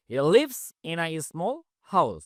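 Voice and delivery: each word of "He lives in a small house" said very slowly and clearly; slow model reading. Shown as a demonstration. Teacher to Bangla class, a very slow model sentence. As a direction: dictate it word by word to students. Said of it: "teacher reads model sentence slowly".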